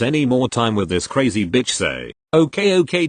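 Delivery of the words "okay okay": high placating okay. Retold or imitated. Placating the yelling mother.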